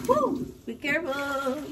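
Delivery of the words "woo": startled woo. Real, not acted. Reacts to hot pan.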